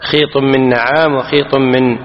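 ordinary talk, nothing marked

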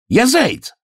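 very high, squeaky protest; high-pitched outraged hare; indignant hare. A narrator's reading. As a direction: cry it out high in disbelief. Hare indignant at not being known.

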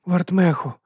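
high, plaintive little bot; mild pleading whine. A narrator's reading. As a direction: call out in a pitiful high voice. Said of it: "жалібно промовив Мікі".

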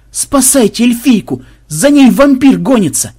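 loud, high, slow cry of alarm; panicked shout. Acted. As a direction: raise the alarm, terrified. Onlookers panic at vampire. (испуганный крик зевак)

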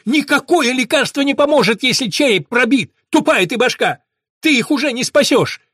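very loud, high desperate yell. A narrator's reading. Dan breaking free, yelling at Mahmed. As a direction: scream it at him. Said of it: "высвободился и завопил".

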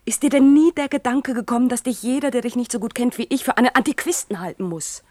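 laut und höher, eindringlich vorwurfsvoll; rüttelt ihn mit lauter Stimme auf; aufgebrachter Vorwurf. Acted. Irma fährt Hans an. (Irma hält Hans laut und aufgebracht seine Außenseiterrolle vor)

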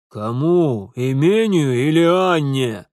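loud, drawn-out sarcastic question; angry challenge. Acted. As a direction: demand an answer with scorn. Father angrily challenges his son.

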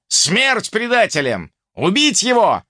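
high, rousing crowd shout; angry battle cry. A narrator's reading. Crowd calls for killing. (яростный клич толпы)

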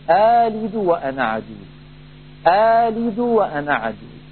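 آلد with a short two-count alif; alif replacing hamza. Shown as a demonstration. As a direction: recite آلد وأنا عجوز with a natural short madd. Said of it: "فيبدل الثانية ألفا فيقول".

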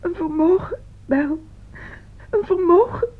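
tearful, struggling to speak; sobbing confession, rising at the end; grief-stricken. Acted. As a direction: choke the words out through tears. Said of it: "Ze kan niet praten, die arme ziel. Ze heeft te veel verdriet".

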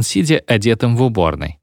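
ordinary delivery, nothing marked

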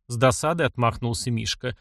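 plain reading voice

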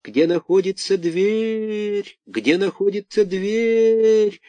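high, slow howl like wind. A narrator's reading. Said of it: "Будто ветер в трубе ... провыл голос".

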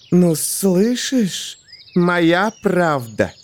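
very slow, high gloating hiss; hissing snake. A narrator's reading. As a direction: stretch each word like a smug snake. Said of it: "прошипела змея".

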